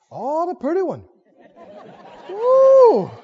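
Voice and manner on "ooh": impressed ooh. Retold or imitated. David impressed by the girl.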